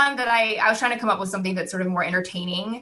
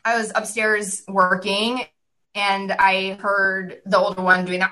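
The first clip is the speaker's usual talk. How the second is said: ordinary talk, nothing marked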